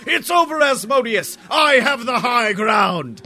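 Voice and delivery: high, shouted heroic movie line; movie hero quote. Retold or imitated. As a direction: proclaim it like a Jedi. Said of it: "I was all like".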